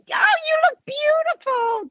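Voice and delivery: high, gushing compliment to her boss; high sweet greeting. Real, not acted. Vlogger gushing to her boss on camera, high and sweet. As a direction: sing it up sweetly, high and bright.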